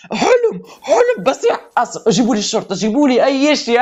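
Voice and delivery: screaming with joy. Real, not acted. Player shouting about calling the police, high excited yelling. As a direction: yell in disbelief at the win. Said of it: "أنا بصرخ حاليًا أصيح".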